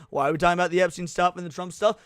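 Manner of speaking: ordinary talk, nothing marked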